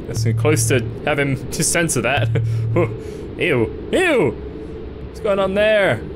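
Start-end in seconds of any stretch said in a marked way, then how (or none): none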